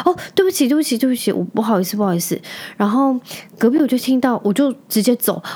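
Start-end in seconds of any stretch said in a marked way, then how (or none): 0.1-2.4 mock flustered apology. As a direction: play the cashier backing down fast. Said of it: "就说".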